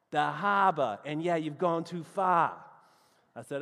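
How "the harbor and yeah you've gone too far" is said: emphatic mock Boston accent, R dropped in "harbor"; imitating an exasperated Bostonian; Boston local accent. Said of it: "here were the directions they gave me".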